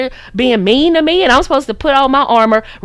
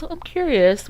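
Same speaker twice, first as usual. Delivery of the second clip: pearl-clutching curiosity; 4 semitones higher, 6 dB quieter than usual. Shown as a demonstration. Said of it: "And grab your pearls".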